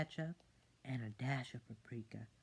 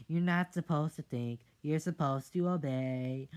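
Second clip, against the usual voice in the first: loud bossy mom voice; strict mother, loud; stern scolding mother; 3 semitones higher, 8 dB louder than usual. Acted. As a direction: lay down the law to the kid.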